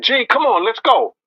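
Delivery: lightly played hurry-up; urging call. Retold urging to leave.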